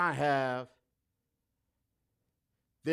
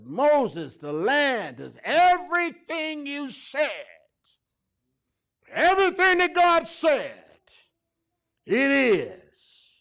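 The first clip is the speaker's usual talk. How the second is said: loud preached report; 10 semitones higher, 12 dB louder, slower than usual. Retold or imitated.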